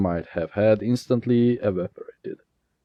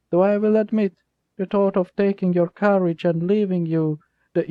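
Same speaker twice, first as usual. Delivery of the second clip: cold threat; 10 semitones higher than usual. Acted. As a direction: let the sweetness turn cold. Jasper threatens driver.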